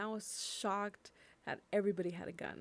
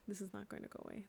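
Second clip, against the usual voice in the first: much softer, sinking repeat of the line; quieter repeat; 10 dB quieter, much faster than usual. Real, not acted. Grieving speaker repeating the warning to herself, softly. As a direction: drop the voice as if to yourself.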